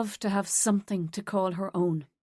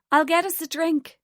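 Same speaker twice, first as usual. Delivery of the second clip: higher and louder, calling over noise; shouting over music; 8 semitones higher, 5 dB louder than usual. Acted. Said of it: "Tara shouted in Cassie's ear".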